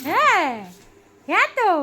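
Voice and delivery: high, swooping playful replies. Real, not acted. Mother answers her baby, high swooping baby talk.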